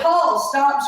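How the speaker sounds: high, loud warning; yelling. Retold or imitated. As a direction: snap him into stopping. Yelling at Paul.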